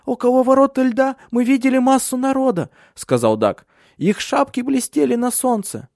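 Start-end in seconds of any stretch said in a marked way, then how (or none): 0.0-2.7 put-on very high, loud child's voice; boy urgently reporting what he saw; excited child. Young Dag reporting in alarm. 4.0-5.9 put-on high-pitched child's voice; boy eagerly adding a detail; excited child. Young Dag reporting in alarm.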